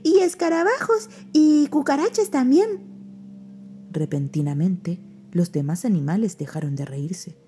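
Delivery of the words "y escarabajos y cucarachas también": high, eager little voice; excited. Acted. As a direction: list them gleefully. Pepe excited about insects.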